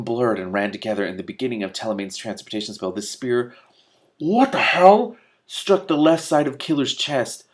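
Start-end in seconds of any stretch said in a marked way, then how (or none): none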